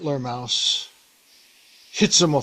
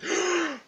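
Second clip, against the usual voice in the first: mice gasp. Retold or imitated. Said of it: "all the mice are like".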